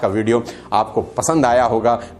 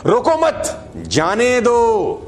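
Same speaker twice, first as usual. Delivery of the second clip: "रोको मत" as one high unit, pause, then drawn-out "जाने दो"; pause after मत; 8 semitones higher, much slower than usual. Shown as a demonstration. Coach demonstrating the line meaning let them go, pause after मत. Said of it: "मत पे मैंने full stop लगाया".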